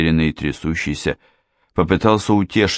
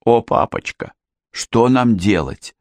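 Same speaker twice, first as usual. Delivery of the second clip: higher, slow pleading; distraught daughter; 5 semitones higher than usual. A narrator's reading. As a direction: plead helplessly with father. Daughter wails to her father. (растерянная мольба)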